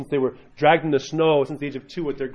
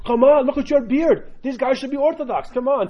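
very high-pitched, insistent monk imitation; monk's excited urging; 12 semitones higher, 3 dB louder than usual. Monk urging visitor to watch Duck Dynasty, high excited. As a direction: coax excitedly in a high put-on voice.

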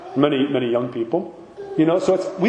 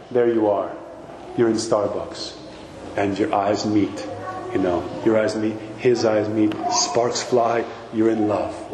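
much lower, slow dreamy storyteller voice; mock romance fantasy; 8 semitones lower, slower than usual. Priest teasing college students about Starbucks love, low and dreamy. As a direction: lull them into a movie-style love-at-first-sight daydream.

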